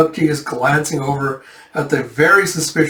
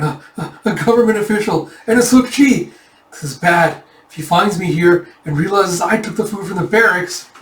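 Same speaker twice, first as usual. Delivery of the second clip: high, stammering mock panic; freaking out; 4 semitones higher than usual. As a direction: panic as if about to be caught, voice raised. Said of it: "King Taejun just freaking out".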